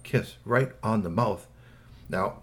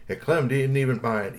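ordinary delivery

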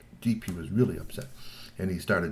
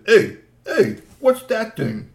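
much higher and louder, nosy call; loud troll voice; 12 semitones higher, 12 dB louder, much slower than usual. Acted. Troll Glad Rab spots recorder.

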